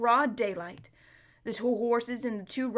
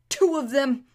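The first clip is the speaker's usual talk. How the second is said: moaning; 3 semitones higher, 6 dB louder than usual. A narrator's reading. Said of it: "moaned Bree".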